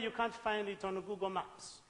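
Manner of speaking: normal talking voice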